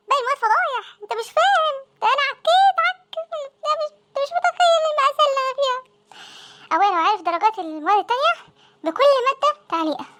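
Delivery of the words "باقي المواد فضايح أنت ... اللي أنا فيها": panicked rant. Acted. Boy panics over failed subjects.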